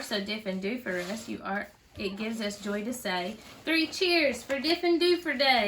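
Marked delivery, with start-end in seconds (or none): 3.6-5.7 cheering. A narrator's reading. Cheering end of poem.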